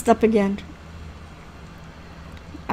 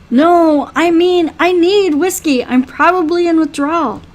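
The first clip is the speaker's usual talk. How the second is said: higher-pitched, pressing protest; insistent demand; 5 semitones higher, 3 dB louder than usual. Acted. Patient demanding whiskey from a reluctant healer, insistent. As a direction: push back and insist on the need.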